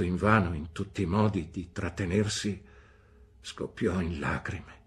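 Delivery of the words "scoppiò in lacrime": usual speaking voice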